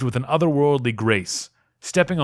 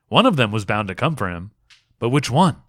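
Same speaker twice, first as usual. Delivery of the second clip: plain reading voice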